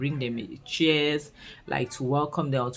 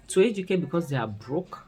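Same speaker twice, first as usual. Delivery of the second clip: usual speaking voice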